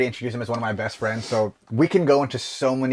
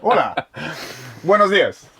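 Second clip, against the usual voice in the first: hammed-up Spanish greeting; 3 semitones higher, 3 dB louder than usual. Playing along with Spanish bit.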